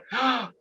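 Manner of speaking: high quick intake of breath in mock amazement; sharp delighted gasp. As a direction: gasp like you just won. Reacting to karma news.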